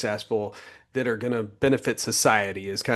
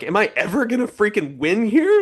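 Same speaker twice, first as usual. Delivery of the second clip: frustrated plea; 9 semitones higher, 3 dB louder than usual. Retold or imitated. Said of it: "you're like".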